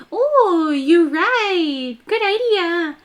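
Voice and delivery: high, breezy girlish imitation; mimicking Caroline. Fan mimicking Caroline's cute reply, light girlish voice. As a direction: chirp it sweetly, like the character. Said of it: "Caroline's like".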